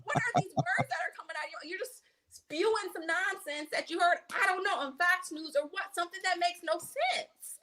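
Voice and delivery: high, laughing disbelief at her; incredulous scolding. Retold or imitated. Woman scolding the old lady at church, incredulous.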